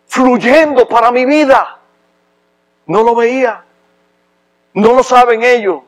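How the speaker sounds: fervent sermon at full force; impassioned preaching. Acted. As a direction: drive each question home from the pulpit.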